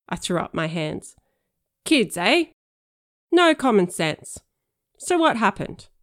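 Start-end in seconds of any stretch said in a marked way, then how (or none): 1.8-5.9 put-on loud, slow, fed-up cop sighing over kids; exasperated cop. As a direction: play the weary cop, loud. Girl plays exasperated cop.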